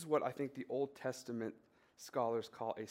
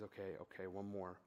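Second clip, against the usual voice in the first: son's words, held back and quiet, close to tears; small choked voice as the boy gives in; choking up; 6 semitones lower, 10 dB quieter than usual. Retold or imitated. A son choking up agreeing with dad to play one more hole. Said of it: "he's kinda choking up".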